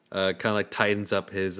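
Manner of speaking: ordinary talk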